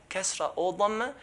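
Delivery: ordinary talk, nothing marked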